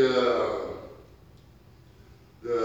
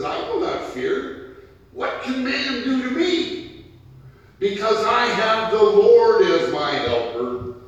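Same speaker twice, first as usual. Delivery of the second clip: much higher and louder, triumphant; ringing proclamation; 11 semitones higher, 10 dB louder than usual. Shown as a demonstration. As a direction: shout the psalm out with faith. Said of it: "He says".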